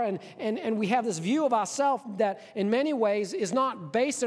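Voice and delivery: forceful preaching. Acted. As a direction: hammer home the point. Preacher pressing a point.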